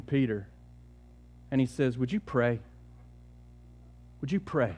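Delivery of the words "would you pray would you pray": gentle plea. Acted. Jesus asks disciples quietly.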